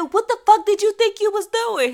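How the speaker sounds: high-pitched indignant demand; retold scolding. Scolding the child once found.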